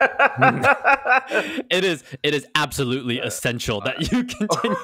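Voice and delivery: laughing through a hammed-up stern command; mock dramatic, laughing. Host mocking the experimenter's line to co-host, laughing mock drama. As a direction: lean into the drama while cracking up. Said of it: "the strength of this language is so dramatic".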